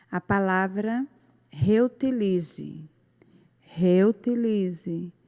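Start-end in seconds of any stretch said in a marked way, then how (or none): 1.5-5.1 reutilize slowly, leaning on LI; stress on LI. Shown as a demonstration. Teacher asks pupils for the tonic syllable, slowly stressing LI in reutilize. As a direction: stretch "reutilize" out and stress LI each time. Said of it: "O li".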